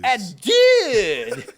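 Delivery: put-on loud, high French-accented shout with a laugh; mock French cry. Capping the intro in a loud mock French accent. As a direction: belt it out like a hammy Frenchman. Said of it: "Why are we French?".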